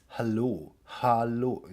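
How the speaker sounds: "hallo" in Standard German pronunciation; German accent. Shown as a demonstration. Language teacher comparing mouth positions, German accent. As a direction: clear German "hallo", pushed forward. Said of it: "in German it's".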